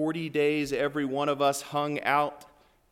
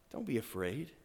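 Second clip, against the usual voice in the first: gentle reassurance; 2 semitones lower, 9 dB quieter than usual. Acted. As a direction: hush it down to reassure. Gentle repeat of Jesus's words.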